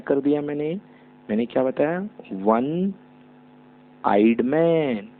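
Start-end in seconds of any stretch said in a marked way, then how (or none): 2.3-5.1 very slow, word by word; slow dictation. Shown as a demonstration. Teacher dictating an example sentence to students. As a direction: spell each word out for the class. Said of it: "slow example word".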